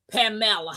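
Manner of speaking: "Pamela" said pa-MELL-a; Spanish mispronunciation. Retold or imitated. Puzzling over the new name, "Pamela" as pa-MELL-a. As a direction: stress the middle of "Pamela", pa-MELL-a. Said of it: "How do you spell that?".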